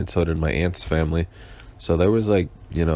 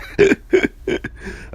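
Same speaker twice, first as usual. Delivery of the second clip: laugh; 3 dB louder than usual. Real, not acted. Laughs at his own voice.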